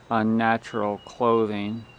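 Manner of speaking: normal talking voice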